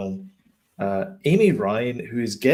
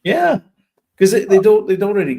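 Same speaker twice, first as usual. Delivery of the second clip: excited outburst; 8 semitones higher, 9 dB louder than usual. Real, not acted. Excited outburst about the cancelled sequel.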